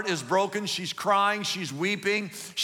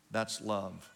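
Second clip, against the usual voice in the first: repeated much softer and lower, almost hushed; soft echo; 9 semitones lower, 8 dB quieter than usual. Shown as a demonstration. Pastor telling the congregation what love is, hushed. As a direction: let it fall away to a hush. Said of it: "You know what you call that?".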